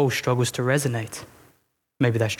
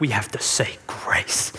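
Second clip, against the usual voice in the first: hushed mock-pious whisper; 5 semitones lower, slower than usual. Church group correcting the newcomer, in a hushed whisper. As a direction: whisper it like a solemn rebuke. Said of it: "they'll be like".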